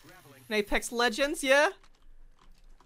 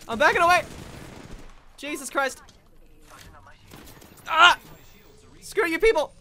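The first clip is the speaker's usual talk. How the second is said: panicked yelps while fleeing; frantic, backing off under fire; being chased; 5 semitones higher, 6 dB louder than usual. Real, not acted. Fleeing enemy in firefight.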